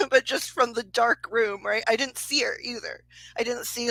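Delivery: ordinary talk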